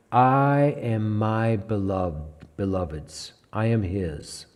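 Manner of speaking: softly, as a bride giving herself; tender quiet reading. Shown as a demonstration. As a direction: let it fall gently, almost hushed. Reading chapter seven, set against six.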